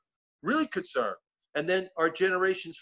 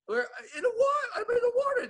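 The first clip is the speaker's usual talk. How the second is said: mock high wail. Retold voice, big pitch jump.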